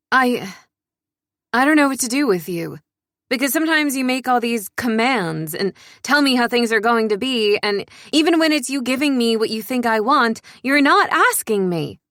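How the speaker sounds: exasperated outburst. Acted. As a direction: let the pent-up frustration spill out, pitch climbing.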